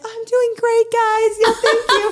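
high, sugary politeness for the nurses; sweet nurse voice. Retold or imitated. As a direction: sound sweet as pie toward the nurses.

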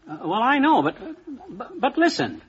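high, flustered, cut-off pleading; senator trying to get the floor. Acted. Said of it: "Sounds like a Republican senator trying to get the floor".

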